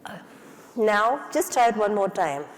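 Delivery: ordinary delivery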